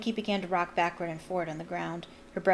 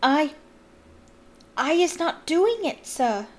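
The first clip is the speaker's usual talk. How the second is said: high, slow frightened elf voice; gasping house-elf; 7 semitones higher, 4 dB louder, slower than usual. A narrator's reading. Winky pleads with Mister Diggory, frightened squeaky elf. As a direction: stammer it out, terrified. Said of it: "Winky gasped".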